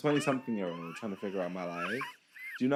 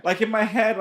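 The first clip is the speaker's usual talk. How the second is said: shocked; 11 semitones higher, 10 dB louder than usual. Real, not acted. Shocked reaction to death news.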